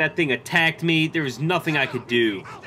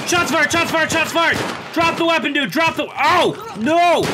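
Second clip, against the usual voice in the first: urgent shouting; 12 semitones higher, 5 dB louder than usual. Pretend. Gunfight inside apartment.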